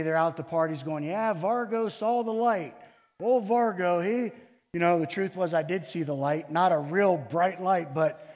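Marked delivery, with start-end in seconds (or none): 1.0-4.3 higher, louder mocking jeer; mocking friends. Friends at a party mocking his conversion, jeering. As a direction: sneer like a rough coworker at a party. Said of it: "my friends were mocking me".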